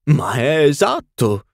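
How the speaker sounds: delighted duchess, up and loud; duchess exclaiming. A narrator's reading. Duchess exclaiming to Lady Windermere, delighted and loud. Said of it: "esclamò la duchessa".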